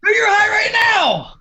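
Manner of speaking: loud, high-pitched exclamation; teasing shout. Real, not acted. Cohost ribbing the giggly guest, teasing shout.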